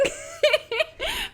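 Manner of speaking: high giddy burst of laughter; dying laughing. Real, not acted. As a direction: crack up helplessly. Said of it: "we just start dying laughing".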